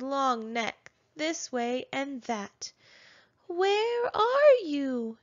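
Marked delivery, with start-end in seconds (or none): 3.5-5.2 high, slow, drawn-out call; nearsighted Bumpy calls for hidden siblings, high slow call. A narrator's reading. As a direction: call out searching, stretched. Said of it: "he called".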